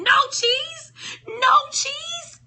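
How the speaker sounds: high, loud shout, near a wail; yelling. A narrator's reading. Hem keeps yelling at the empty station, high and loud. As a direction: shout it over and over in despair. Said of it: "He continued yelling".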